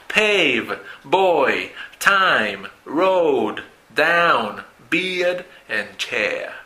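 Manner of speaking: put-on "Pave, boy, time, road, down, beard" each glide stretched and falling; diphthong demo. Shown as a demonstration. Teacher repeats the words Pave to chair, diphthong demo. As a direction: draw out every diphthong from Pave to chair, word by word. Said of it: "diptongos que tienen dos posiciones".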